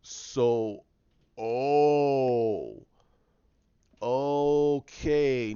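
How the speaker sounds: slow, drawn-out realization, voice lifting on "okay"; dawning realization. Retold or imitated. Finn and Poe finally understand what's going on, slow dawning surprise. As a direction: let it slowly sink in. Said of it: "Finn and Poe look at each other like".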